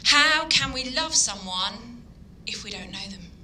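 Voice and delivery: ordinary delivery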